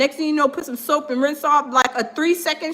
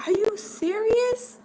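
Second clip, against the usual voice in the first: slow, higher, softer disbelief; a stunned shopper asking in disbelief; 7 semitones higher, 4 dB quieter, slower than usual. Retold or imitated. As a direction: ask it stunned. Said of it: "I was like".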